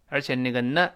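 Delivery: ordinary delivery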